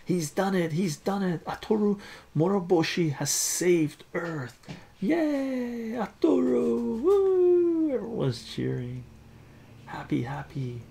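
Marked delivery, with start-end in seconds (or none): 0.1-4.6 high, loud triumphant call; victory shout to the crowd; excited announcer. Acted. Announcer declares victory at contest. 5.0-7.9 very high, loud cheering whoops; squealing hooray; crowd cheering. Acted.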